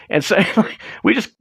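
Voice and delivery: ordinary delivery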